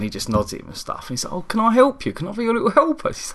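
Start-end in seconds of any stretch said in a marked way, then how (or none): 1.3-3.1 put-on eager kid voice. Voicing the eager little kid.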